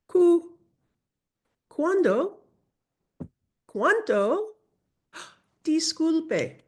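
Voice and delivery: teacher leading a repeat-after-me drill; pronunciation drill. Shown as a demonstration. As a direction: call out "co", "con", "Banco" clearly for students. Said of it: "Repeat after me".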